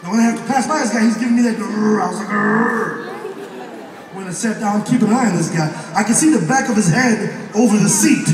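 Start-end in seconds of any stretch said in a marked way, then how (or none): 2.3-3.1 growl. Retold or imitated. Growling while passing him.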